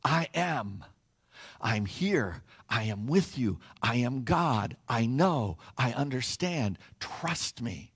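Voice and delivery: low, soft, soothing words; quietly comforting, intimate and low; tender reassurance. Acted. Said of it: "he looks at you and me and he says".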